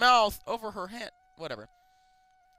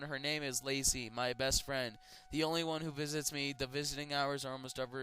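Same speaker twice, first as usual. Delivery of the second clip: ordinary talk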